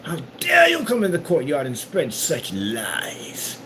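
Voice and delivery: hammed-up gentleman's challenge, pitched high with a hushed middle; mock old-fashioned gentleman demanding satisfaction; old-time duelist. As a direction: play a pompous duelist calling out a liar. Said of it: "I saw that nonsense like".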